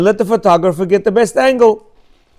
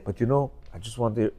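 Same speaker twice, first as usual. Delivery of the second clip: tender compliments; 5 semitones lower than usual. Shown as a demonstration. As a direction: murmur your fond thanks gently. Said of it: "And then say".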